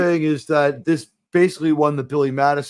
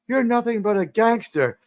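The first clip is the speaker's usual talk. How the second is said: quoted woman; 8 semitones higher than usual. Retold or imitated. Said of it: "some woman's like".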